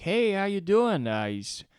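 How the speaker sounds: loud, high, overly friendly officer voice; a cop being pushy-cheerful; aggressively nice cop. Retold or imitated. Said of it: "aggressively nice about it".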